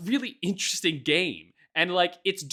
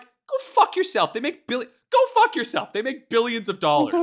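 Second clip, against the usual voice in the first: exasperated retort; 9 semitones higher, 6 dB louder than usual. Retold or imitated. Answering defenders' excuses, loud high exasperated retort. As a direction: fire it back in mock outrage.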